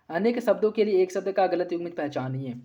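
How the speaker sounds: normal talking voice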